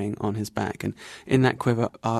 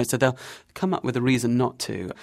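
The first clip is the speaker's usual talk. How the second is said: ordinary talk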